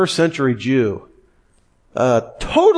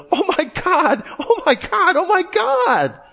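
much higher, laughing, squealing mock contestant; excited contestant. Contestant reaching the stage, giddy laughing squeal. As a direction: gush like a stunned game show winner. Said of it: "the woman comes down and she says".

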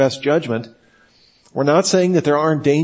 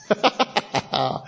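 laugh; 2 semitones higher, 6 dB quieter than usual. Real, not acted. Speaker laughing at his own joke about jargon, laugh. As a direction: burst of chuckling laughter.

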